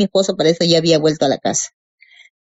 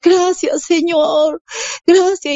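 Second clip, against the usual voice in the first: mucho más agudo, dando gracias con júbilo; agradecimiento eufórico; 9 semitones higher, much slower than usual. Retold or imitated. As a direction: agradece a Dios con todo el corazón. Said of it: "decía". (grito de gratitud muy agudo)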